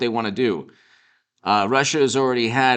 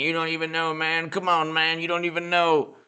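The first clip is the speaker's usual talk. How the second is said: higher-pitched mock politician voice; Mashed Potato Brain; 4 semitones higher, 3 dB quieter than usual. Host mocking the president's empty threats, mock politician voice. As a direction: hold it on one high, mumbling monotone. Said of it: "you have Mashed Potato Brain saying".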